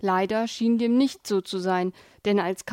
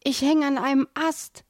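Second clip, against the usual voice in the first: higher, plaintive call from below; pitiful call; 5 semitones higher than usual. A narrator's reading. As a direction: wail your predicament up the ravine. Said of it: "hallte es jämmerlich".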